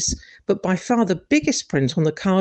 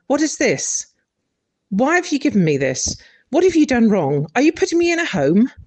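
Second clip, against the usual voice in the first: put-on higher, faster suspicious old mother; indignant mother; 5 semitones higher than usual. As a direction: fire off the questions in disbelief. Mum voiced, indignant questions.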